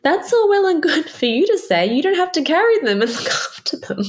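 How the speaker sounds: imitating an indignant outburst, laughing at the end; indignant retort. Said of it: "And I was like".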